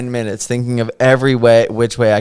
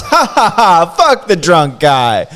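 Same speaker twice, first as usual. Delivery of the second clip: loud, high, slow gloating laugh; mocking laugh; 6 semitones higher, 6 dB louder, slower than usual. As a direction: laugh it out cruelly. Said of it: "Laughed at my boss".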